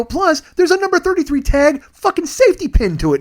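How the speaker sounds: high, loud indignant rant; re-enacted exasperated yelling at the clerk; mad outburst. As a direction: explode in high-pitched outrage. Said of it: "I'm getting mad now".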